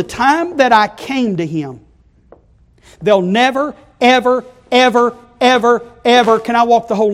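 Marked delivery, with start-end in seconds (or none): 3.0-7.1 rising loud, high repeated evers; fervent preaching. Acted. Preacher to congregation, loud rising evers. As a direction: hammer each ever harder.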